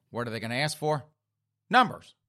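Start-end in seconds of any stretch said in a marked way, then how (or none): 1.7-2.2 loud, high punch on one word; emphatic punchline. Acted. Storyteller mocking game show contestants, emphatic punchline. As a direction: land it like an obvious answer.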